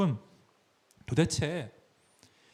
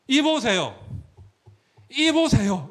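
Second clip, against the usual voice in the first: loud, high and indignant "이보세요"; rude angry address; 8 semitones higher, 5 dB louder, slower than usual. Shown as a demonstration. Son refusing to call him father, yelling at him. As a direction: snap "이보세요" at him like a stranger. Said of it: "이러면서 큰아들이 말을 이어간단 말입니다".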